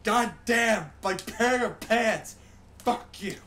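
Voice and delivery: loud, high, furious outburst; angry yelling. Real, not acted. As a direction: blow up at yourself, full volume. Angry at his own slip.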